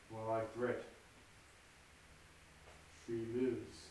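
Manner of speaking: low, soft brooding murmur to himself; hushed soliloquy. Acted. Macbeth alone before the murder, low and hushed.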